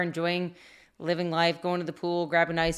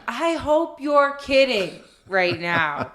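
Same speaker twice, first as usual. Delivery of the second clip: mock yell; 6 semitones higher, 3 dB louder, much slower than usual. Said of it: "And I scream down".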